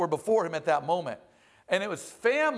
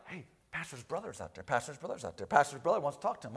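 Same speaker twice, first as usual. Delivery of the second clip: hushed, much softer rumour passed along; whispered gossip; 7 semitones lower, 8 dB quieter, faster than usual. Retold or imitated. A church member whispering to the next pew about the pastor's brother. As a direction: pass the rumour along under your breath. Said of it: "the whispers start going around".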